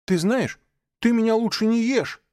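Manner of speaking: high, loud frightened warning. Acted. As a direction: warn him off at the top of the voice. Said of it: "предупредил Маугли".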